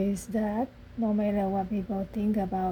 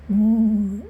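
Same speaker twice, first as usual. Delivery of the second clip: a big, louder, drawn-out moo; loud mock moo; 8 dB louder than usual. Goat answering Duck's hello, loud mock moo. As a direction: belt out a silly farm-animal moo.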